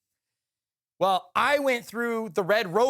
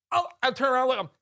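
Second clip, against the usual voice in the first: normal talking voice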